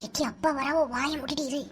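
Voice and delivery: soft urgent hush; hushed warning. Acted. Sister warning her sibling as father approaches, hushed. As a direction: drop the voice so dad won't hear.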